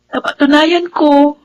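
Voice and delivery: slow, sincere closing vow; slow it down and mean it; hopeful. Acted. Daughter thanking family, slow and hopeful.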